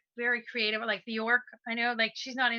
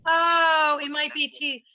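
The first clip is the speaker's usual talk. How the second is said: surprised; 5 semitones higher, 6 dB louder, slower than usual. Real, not acted. Sudden realization about bandwidth.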